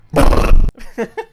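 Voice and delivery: loud breathy laugh. Acted. Breathy laugh after joke.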